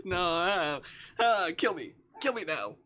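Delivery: jokingly begging to be put out of misery; mock mortified. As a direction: wail it in hammed-up embarrassment. Mock mortified at own joke.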